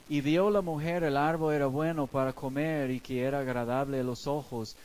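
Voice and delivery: ordinary delivery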